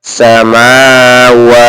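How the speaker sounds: সামাওয়া with the middle ওয়া held long; teacher drawing out সামাওয়া slowly; drawn-out long vowel. Shown as a demonstration. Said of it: "তাই টান দিলাম".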